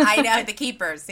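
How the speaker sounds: loud eager agreement; excited. Real, not acted. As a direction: jump in loud.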